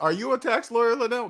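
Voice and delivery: lightly voiced questioner. People asking the host his profession, lightly voiced questioner.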